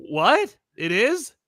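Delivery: mock bewildered disbelief; baffled. Wolverine answering Professor X's odd reassurance, baffled.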